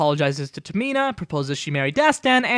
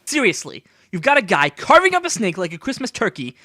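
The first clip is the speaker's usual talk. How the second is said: usual speaking voice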